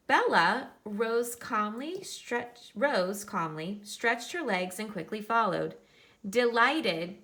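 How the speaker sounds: picture-book reading voice; reading to children. Acted. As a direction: read it out brightly for the class. Reads picture book aloud to class.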